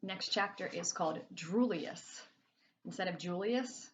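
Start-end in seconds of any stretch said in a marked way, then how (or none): none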